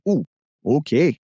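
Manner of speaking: a light mock Minnesota "Ooh, okay"; playful imitation of Minnesota speech; gently hammed-up Upper Midwest accent. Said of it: "I go to Minnesota and I just say".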